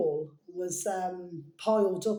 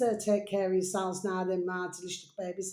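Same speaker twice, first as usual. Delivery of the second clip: usual speaking voice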